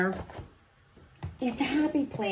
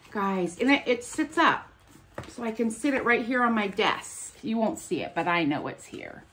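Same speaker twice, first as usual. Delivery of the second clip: ordinary delivery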